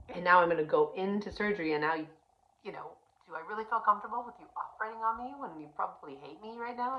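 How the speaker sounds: pushing back hard, voice climbing; upset pleading. Retold or imitated.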